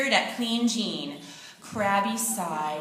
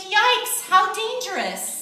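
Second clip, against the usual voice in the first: much higher, startled yelp of alarm; high, louder alarmed cry; alarmed exclaim; 9 semitones higher, 3 dB louder, slower than usual. A narrator's reading. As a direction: gasp it out in sudden fright. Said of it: "she exclaimed".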